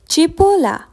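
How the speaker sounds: Cipolla said chee-POL-lah, high and clear; Italian word model. Shown as a demonstration. Teacher stresses the Italian word for learners. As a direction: model Cipolla with a lifted first syllable. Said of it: "Focus on the pronunciation".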